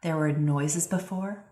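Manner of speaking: ordinary talk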